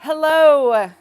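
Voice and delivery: loud, high stage greeting; big hello. Real, not acted. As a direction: burst in with a grand hello. Host bursts onto stage greeting.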